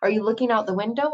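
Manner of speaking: usual speaking voice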